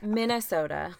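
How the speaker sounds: Minnesota with long rounded Minnesota o and a rising lilt; Minnesota accent. Shown as a demonstration. Showing off the Minnesota accent to her partner, Minnesota accent. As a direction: stretch Minnesota out, Upper Midwest style. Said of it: "I'm so proud of you".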